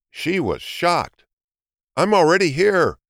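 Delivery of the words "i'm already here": higher, louder triumphant cheer. A narrator's reading. Hedgehog cheers at the finish line. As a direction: crow it out in triumph. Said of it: "cheered the hedgehog".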